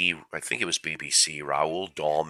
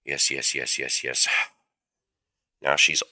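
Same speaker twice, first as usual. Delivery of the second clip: usual speaking voice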